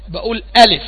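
ordinary delivery

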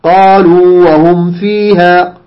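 very slow chant, قالوا stretched on a long uu; slow, measured madd on قالوا and فيها; model recitation. Shown as a demonstration. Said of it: "هكذا".

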